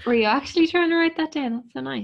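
surprised. Real, not acted. Delighted friend wrote notes.

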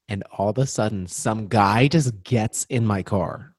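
ordinary talk

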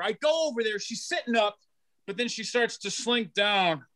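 normal talking voice

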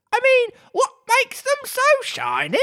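very high squeaky voice; Griffin. A narrator's reading. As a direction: pipe it up high. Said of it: "said the Griffin".

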